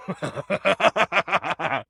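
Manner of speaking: high, gleeful dark chuckle; smug evil laugh; amused villain chuckle. Acted. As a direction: laugh at the little shade.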